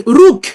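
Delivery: Ruk clipped to one syllable, hard k; Ruk without the patah. Shown as a demonstration. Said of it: "se podría pronunciar". (Ruk sin la a)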